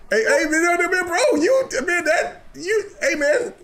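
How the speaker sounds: high, sputtering protest; flustered sputter. Real, not acted. Host sputtering at a crude joke, flustered and high.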